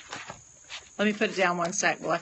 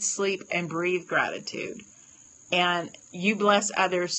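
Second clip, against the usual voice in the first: usual speaking voice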